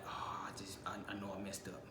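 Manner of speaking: hushed, breathless whisper. Retold or imitated. Alone after the crash, a scared whisper to himself. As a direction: murmur it to yourself in dread.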